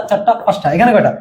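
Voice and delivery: ordinary talk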